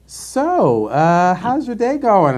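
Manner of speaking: lightly voiced chirpy trainer, slow; a hint of a sweet trainer; cheery trainer. Trainer cracking a joke, lightly voiced chirpy. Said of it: "she's like".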